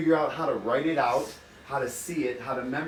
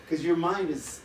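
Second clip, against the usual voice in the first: ordinary talk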